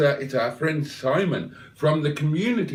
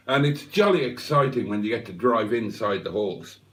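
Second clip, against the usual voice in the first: ordinary delivery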